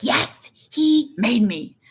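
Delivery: put-on slow, high, insistent kid voice; hammed-up little-girl protest; imitated tattling child. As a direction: drag it out like a whiny tattletale. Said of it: "that's how she talks".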